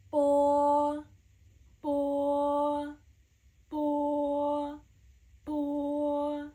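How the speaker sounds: "bo" said with rounded lips, slow and clear, each one fading; Mandarin o. Shown as a demonstration. Teacher drills "bo" for learners, clear demo. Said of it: "in Chinese it's pronounced as o".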